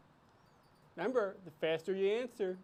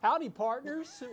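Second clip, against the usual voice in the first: put-on higher-pitched Western cowboy greeting, cowboy accent; 7 semitones higher, 3 dB louder than usual. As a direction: greet the crowd like a cheerful cowboy sheriff with the accent. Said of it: "I'm fully embracing, embracing it".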